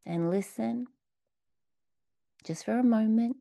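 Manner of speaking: unhurried meditation guidance; calm guiding voice for the exercise; guided meditation. Acted. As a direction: lead them gently into the question. Leads the meditation again.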